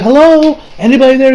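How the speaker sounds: high, loud, questioning call. Retold or imitated. Retelling Koichi hailing the dazed boy, high calling voice. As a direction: call out to someone not responding.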